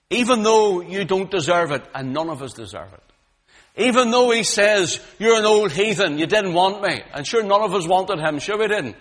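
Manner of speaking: loud, high, rising preacher's cry; full-force preaching. Acted. Preacher crying God's love to the congregation, full force. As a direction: preach it out to the whole hall.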